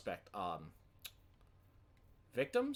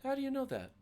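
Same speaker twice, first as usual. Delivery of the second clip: higher, louder startled question; surprised; 5 dB louder, faster than usual. Acted. Chef startled that the driver knows her hometown, surprised. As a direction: caught off guard that a stranger knows. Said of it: "pick up on the surprise in her voice".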